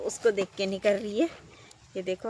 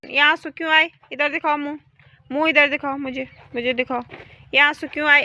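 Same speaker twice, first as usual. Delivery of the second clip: comforting; 6 semitones higher, 8 dB louder than usual. Real, not acted. Comforting the crying cow.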